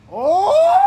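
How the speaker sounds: short piercing shriek, very high and loud; funny high scream. Acted. Narrator screams for a character mid-story, funny shriek. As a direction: let out a sudden comic shriek. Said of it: "that was a funny scream".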